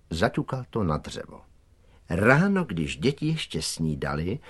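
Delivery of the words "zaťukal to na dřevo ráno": plain reading voice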